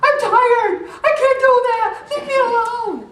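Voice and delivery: high, loud, laughing mock meltdown; mock freak-out. Overwhelmed woman snapping after complying too long, high and loud. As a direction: ham up a frazzled outburst, laughing. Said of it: "freak out".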